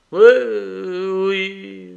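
high, drawn-out, halting assent; slow dying murmur. A narrator's reading. Dying man lost in his thoughts, slowly halting. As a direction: stretch each word with long hesitation. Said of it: "murmura lentement le mourant en faisant de longues pauses".

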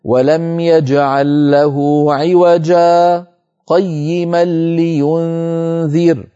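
slow chanted tajweed recitation; Quran recitation. Shown as a demonstration. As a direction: recite it slowly with measured tajweed. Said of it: "من قوله تعالى في سورة الكهف". (تلاوة مجودة بطيئة)

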